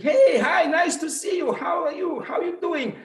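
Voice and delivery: high, bright-pitched happy hellos; joyful greeting. Retold or imitated. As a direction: greet old friends with delight. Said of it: "It's like".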